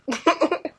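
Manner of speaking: high burst of laughter; real loud, high laugh. Real, not acted. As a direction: crack up mid-confession. Laughs mid-confession.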